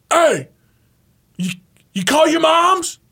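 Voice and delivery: high, loud mock coach shout; mock coach yell. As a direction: bark it like a twangy coach. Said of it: "And he'd go".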